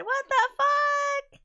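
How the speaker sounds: high, slow disbelieving what the fuck; exasperated outburst. Real, not acted. Streamer as the stream breaks again, high disbelief.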